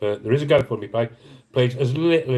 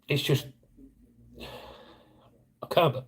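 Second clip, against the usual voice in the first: words catching in the throat; fighting back tears, barely getting it out; choked up; 5 semitones higher, 6 dB quieter, much slower than usual. Real, not acted. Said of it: "I'm just lost word for it".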